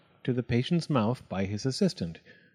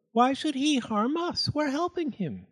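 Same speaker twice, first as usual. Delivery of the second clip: light wifely voice, pitched way up; protesting wife; 14 semitones higher than usual. A narrator's reading. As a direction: plead the case to a doubting husband. Wife defends fox to husband.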